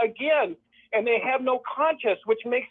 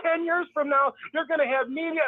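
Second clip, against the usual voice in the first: higher, fake-thrilled gushing; mock gushing; 7 semitones higher than usual. Mocking how members must applaud the plans, high fake gushing. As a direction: gush like a starry-eyed believer. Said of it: "We're supposed to go".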